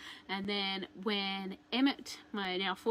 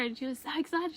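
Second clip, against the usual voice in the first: ordinary talk, nothing marked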